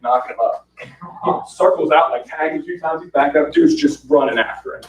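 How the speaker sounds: ordinary talk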